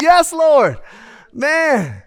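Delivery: high, loud cry of delight; rapturous praise. Acted. Diner over good chicken, rapturous praise. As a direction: belt it like a church amen.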